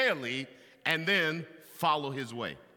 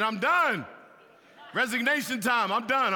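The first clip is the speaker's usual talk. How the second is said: loud, higher, emphatic I'm done; declaring he quits; 6 semitones higher, 4 dB louder than usual. Retold or imitated. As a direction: slam the door on it. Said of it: "And I'm like".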